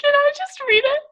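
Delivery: high, cracking up as she asks; laughing plea. Real, not acted. Host offering to read co-host's line, helplessly laughing. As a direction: plead through helpless laughter.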